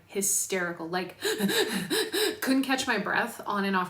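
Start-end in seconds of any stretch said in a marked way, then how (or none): 1.2-2.4 rueful laugh. Real, not acted. Laughs recalling hysterical crying.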